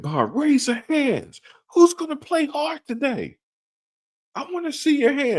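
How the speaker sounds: high, loud coach yell at the team. Retold or imitated. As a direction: bark it like a fired-up high school coach.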